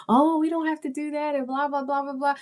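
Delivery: put-on high, quick girlish voice; amused daughter. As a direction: chatter it brightly like a young girl. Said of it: "she was like".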